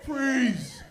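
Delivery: high, loud shout of a cop; barked cop command. Retold or imitated. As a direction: stop the suspect cold. Cop yelling again.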